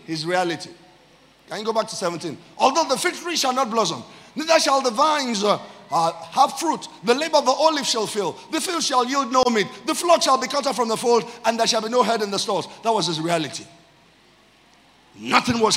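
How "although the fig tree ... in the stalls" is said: high, driving recital of the verse; scripture declamation. Real, not acted. Preacher reading the failed harvest verse to church, scripture declamation. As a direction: roll through the list of losses with rising urgency.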